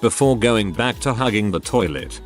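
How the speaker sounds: ordinary delivery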